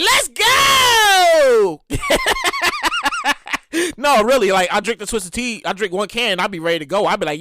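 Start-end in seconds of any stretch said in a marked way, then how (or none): 0.0-1.8 higher, louder "let's go" yell; hyped shout. Retold or imitated. After a drink, hyping himself up, excited yell. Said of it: "And I'll be like". 1.9-3.9 squealing high laugh; high burst of laughter. Real, not acted. Host laughing at his own hype, high squealing laugh.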